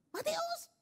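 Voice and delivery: very high-pitched parental call. Acted. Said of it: "Nah, naik tuh intonasinya".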